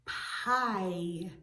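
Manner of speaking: "Pie" with a long, stretched I; drawn-out vowel. Shown as a demonstration. Teacher asking children to hear the I sound, drawn-out "Pie". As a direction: hold the vowel of "Pie" slowly. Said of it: "Do you hear an I sound".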